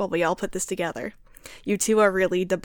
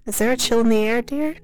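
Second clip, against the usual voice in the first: drowsy; 3 semitones higher, 5 dB louder than usual. A narrator's reading. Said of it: "she said drowsily".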